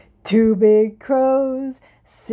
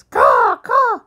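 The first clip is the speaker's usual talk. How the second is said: high, loud bird squawk; noisy raven; 11 semitones higher, 6 dB louder than usual. Pretend. Grandparent's raven butts into the bedtime show, noisy squawking. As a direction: caw out like a pushy crow. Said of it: "he's really a noisy bird".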